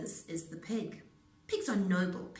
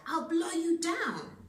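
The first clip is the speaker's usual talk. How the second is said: loud, slow, higher menace; wolf threat; 9 semitones higher, 6 dB louder than usual. A narrator's reading. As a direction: draw the threat out slowly.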